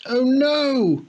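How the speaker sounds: high, loud panicked cry; sudden dismay. A narrator's reading. Boy realising his bike is gone, high panic. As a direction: gasp it in alarm.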